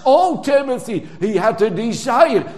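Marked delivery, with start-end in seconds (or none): 0.1-1.1 "Oh, Timothy" high and loud, stress on Oh; urgent plea. Shown as a demonstration. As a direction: urge him by name. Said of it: "not as a dead complaint, but as an urge".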